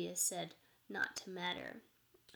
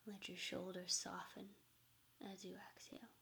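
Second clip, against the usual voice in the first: soft spa whisper; 5 dB quieter than usual. Acted. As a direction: ease them into calm breathing. Leads a meditation.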